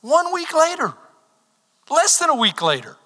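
impassioned preaching. Acted. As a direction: press the point hard. Preaching at full force again.

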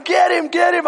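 high, loud imitated urging; egging-on yell. Little brother urging him to finish the bully, egging-on yell. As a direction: goad him on with high shouts. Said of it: "then he starts yelling".

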